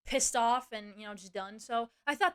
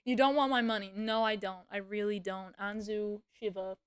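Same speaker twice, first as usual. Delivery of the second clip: ordinary talk, nothing marked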